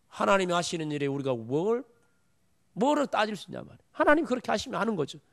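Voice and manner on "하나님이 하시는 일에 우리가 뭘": usual speaking voice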